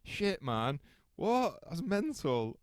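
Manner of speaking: a stunned what, thrown up high; mock astonishment. Hearing his friend knows her, mock astonished and high. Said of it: "I was like".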